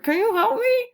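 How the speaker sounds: very high, strange pleading voice; a trapped creature begging. A narrator's reading.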